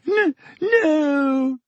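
high, stammered sob; sobbing. A narrator's reading. Lavender answers about her rabbit, high hammed-up sobbing. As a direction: sob out a broken no. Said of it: "sobbed Lavender".

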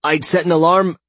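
high, loud startled protest; agitated and caught off guard; alarmed protest. Acted. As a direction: protest in disbelief, pitch up. Startled protest after losing hours.

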